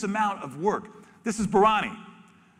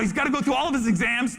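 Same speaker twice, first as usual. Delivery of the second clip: normal talking voice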